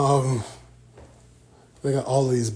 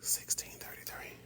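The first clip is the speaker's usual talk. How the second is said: very soft whispered aside; hushed, voiceless murmur; 17 dB quieter than usual. Real, not acted. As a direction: whisper it to yourself. Murmurs date while handling book.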